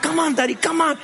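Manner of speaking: daughter urging. Retold or imitated. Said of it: "she went in my back".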